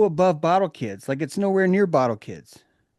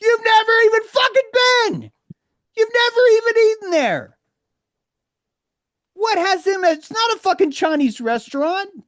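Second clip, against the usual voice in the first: very high, loud shouting; shouted retort; 5 dB louder than usual. Retold or imitated. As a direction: blast back at him in full voice. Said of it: "I'm like".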